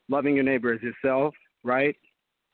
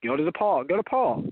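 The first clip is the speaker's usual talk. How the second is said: ordinary talk, nothing marked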